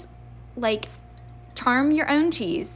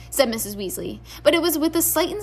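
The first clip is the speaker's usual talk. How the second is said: ordinary delivery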